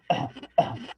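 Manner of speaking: a short dry cough, shown on purpose; demo cough. Shown as a demonstration. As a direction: cough once for the class, clearly. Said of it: "This is cough".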